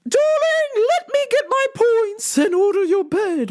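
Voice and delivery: high, far-off voice for a doting mom; mom impression. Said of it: "So she was just like".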